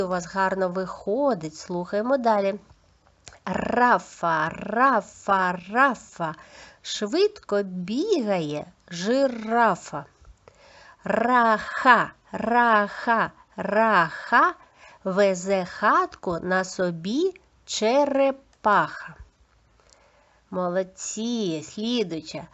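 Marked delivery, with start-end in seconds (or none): 3.4-10.0 trilled R in "Рафа" and "жирафа"; clear articulation. Shown as a demonstration. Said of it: "Чітко вимовляйте, будь ласка, повторюйте". 11.0-19.1 long rolled R in "Раха" and "черепаха"; teacher modelling a strong trill in "Раха"; clear articulation. Shown as a demonstration. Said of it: "Чітко вимовляйте, будь ласка, повторюйте".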